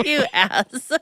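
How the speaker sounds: teasing jab through real laughter; laughing insult. Real, not acted. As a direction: laugh as you call them out. Teasing him after his leg joke.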